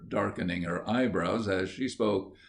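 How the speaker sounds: usual speaking voice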